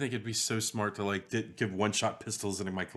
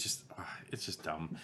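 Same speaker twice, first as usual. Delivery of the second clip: whisper; 6 semitones lower, 9 dB quieter than usual. Real, not acted. Whispered aside about the gun.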